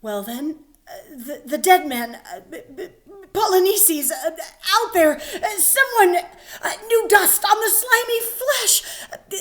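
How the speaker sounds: high, halting, nervous laughter and sobs; stammering sentry. Acted. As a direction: stumble through the bad news. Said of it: "he's totally nervous".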